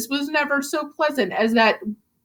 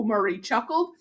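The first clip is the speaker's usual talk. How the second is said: ordinary delivery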